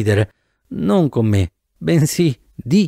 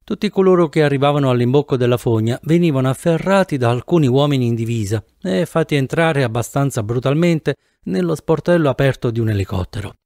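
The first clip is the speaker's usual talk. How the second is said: ordinary delivery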